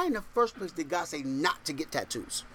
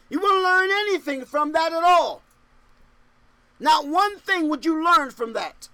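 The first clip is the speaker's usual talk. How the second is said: raised, higher-pitched preaching voice; emphatic preaching; 7 semitones higher, 5 dB louder than usual. Real, not acted. Preacher warns against blabbing people's problems, emphatic and raised. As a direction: drive the point home to the congregation.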